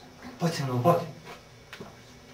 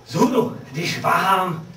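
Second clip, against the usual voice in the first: a sharp, much higher call of up!; sudden cry; 5 semitones higher, 6 dB louder, slower than usual. Acted. Faust urging hesitant self forward, sudden loud cry. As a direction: spur the hesitant one on loudly.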